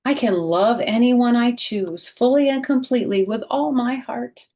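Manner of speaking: bright, expressive picture-book read-aloud; reading to children. A narrator's reading. Teacher reading a picture book aloud to children, expressive.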